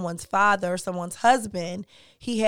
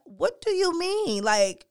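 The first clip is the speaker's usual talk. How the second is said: incredulous; 8 semitones higher than usual. Real, not acted. About grandsons told not to cry, high incredulous disbelief. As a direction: stretch it out in disbelief.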